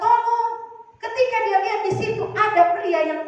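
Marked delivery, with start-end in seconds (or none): 0.0-0.5 high, short call for help; hammed-up, high-pitched plea; mock cry for help. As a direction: imitate a woman calling out for rescue. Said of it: "Tapi dia berteriak".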